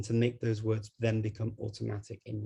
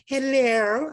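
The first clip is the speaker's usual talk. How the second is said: high, loud posh telephone hello; grandma's phone voice; 9 dB louder than usual. Retold or imitated. Grandma answering the phone in her posh voice. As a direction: put on your best phone voice like grandma. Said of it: "my grandma used to say when she picked up the phone and she'd go".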